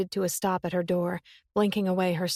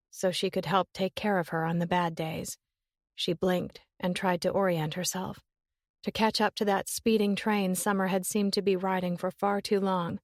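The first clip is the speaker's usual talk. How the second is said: ordinary delivery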